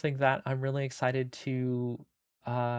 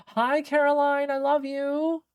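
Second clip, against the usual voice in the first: squeaky cartoon voice; 13 semitones higher, 4 dB louder than usual. Greeting a chatter in put-on voice.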